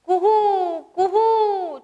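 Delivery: high, sung-out kuhu like a cuckoo; cuckoo call. Shown as a demonstration. Teacher showing the class a koel's call. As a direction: call Kuhu high and long, like a koel. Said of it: "सगळ्यांनी कोकिळेचा आवाज काढायचा आहे".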